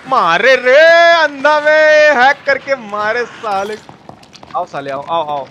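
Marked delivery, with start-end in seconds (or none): none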